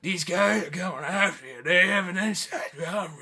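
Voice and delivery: slow, slurred mumbling impression; unclear Nick Nolte. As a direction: imitate an actor who can barely be understood. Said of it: "I don't remember him being that unclear".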